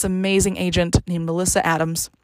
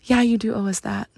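spirit of CBU. Retold or imitated. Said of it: "this is the spirit of C B U talking".

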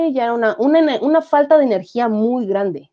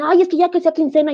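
mock impatient grumbling; 7 semitones higher than usual. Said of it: "mientras todos se mordían".

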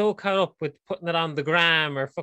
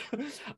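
breathless laugh; 9 semitones higher, 12 dB quieter than usual. Real, not acted. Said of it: "Stop making me laugh".

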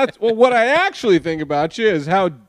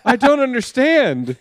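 comedian impression; 8 semitones higher, slower than usual. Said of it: "the thing that made me laugh was like".

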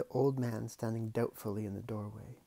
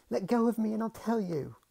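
put-on girl's voice; 10 semitones higher, 8 dB louder than usual. Girl in pain.

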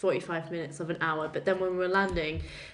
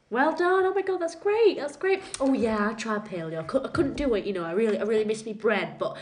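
louder and higher, over-the-top congratulations; mock gushing praise; 3 semitones higher, 6 dB louder than usual. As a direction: gush fake enthusiasm over the weight loss.

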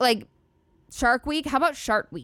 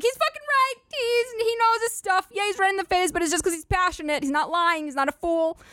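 much higher, hammed-up fanboy voice; mock fervent agreement; 10 semitones higher than usual. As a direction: gush like a true believer defending his hero. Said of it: "that I'd be like".

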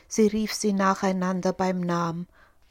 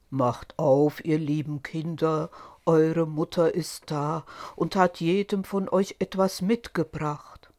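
lower, rough wolf; 4 semitones lower than usual. Acted. Wolf at the door fakes being mother, low and rough. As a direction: growl it low like a disguised wolf. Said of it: "hörten an der rauen Stimme".

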